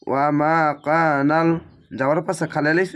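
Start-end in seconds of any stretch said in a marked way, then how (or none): 0.1-1.5 ওয়া মা কানাল drawn out slowly, vowels held one alif; slow chanted ওয়া মা কানাল; Quranic recitation. Shown as a demonstration. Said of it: "এক আলিফ টেনে পড়বেন".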